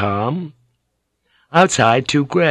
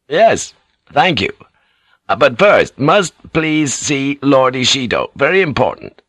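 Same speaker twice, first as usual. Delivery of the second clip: halting, higher-pitched plea in broken English; urgent, polite plea; 5 semitones higher, 3 dB louder than usual. Acted. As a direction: press politely for an audience, word by word. Blackthorne pleads in halting speech.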